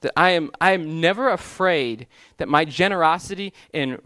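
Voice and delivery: ordinary delivery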